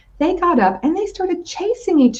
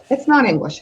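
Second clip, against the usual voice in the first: plain reading voice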